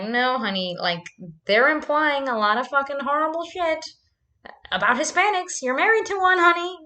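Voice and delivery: wife's retold protest, rising high and loud; exasperated arguing. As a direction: act out scolding a husband, building in pitch. Acting out argument with husband.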